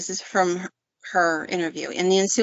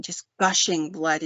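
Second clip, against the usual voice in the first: ordinary talk, nothing marked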